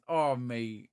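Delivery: ordinary talk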